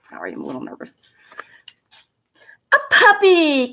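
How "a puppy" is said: high, loud delighted squeal. A narrator's reading. Girl seeing the new puppy, high delighted squeal. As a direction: squeal with joy. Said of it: "Cassie squealed".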